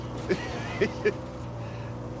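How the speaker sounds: breathy chuckle at his punchline; laughing at own joke. Real, not acted. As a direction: crack up at your own joke.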